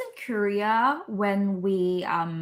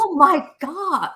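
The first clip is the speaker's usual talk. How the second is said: mock gushing; 6 semitones higher, 6 dB louder than usual. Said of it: "Like, these things".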